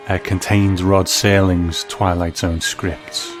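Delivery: ordinary delivery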